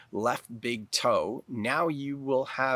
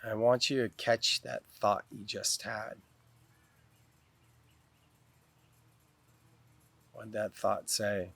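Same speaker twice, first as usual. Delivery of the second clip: guided meditation. Real, not acted. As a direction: guide them calmly into the breath, unhurried.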